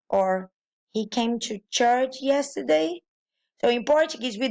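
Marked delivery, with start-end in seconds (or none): 0.9-3.0 "He came to church yesterday?" higher, rising on yesterday; question by intonation. Shown as a demonstration. Learner asking by tune alone, "yesterday" rising. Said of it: "Interrogatives in Portuguese are conveyed by intonation".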